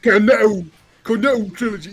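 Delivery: loud, high posh mimicry of "Cornetto"; mock posh accent. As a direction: blow up the posh vowels mockingly. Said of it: "You said it like that".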